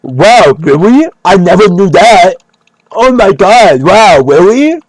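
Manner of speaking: high, loud mocking copycat voice; hammed-up mock amazement, taunting him to his face; Joe Rogan. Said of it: "copying him and fucking making fun of him to his face".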